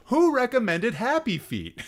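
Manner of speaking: high, loud disbelieving question; loud, high-pitched and aghast; incredulous outburst. Real, not acted. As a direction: demand it in comic outrage. Mock outrage at a recommendation.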